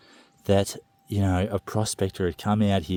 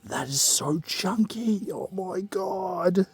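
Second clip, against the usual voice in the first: awed delight; 10 semitones higher, slower than usual. Real, not acted. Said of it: "I'm so excited".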